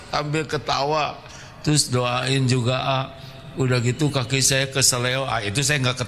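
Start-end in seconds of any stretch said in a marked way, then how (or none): none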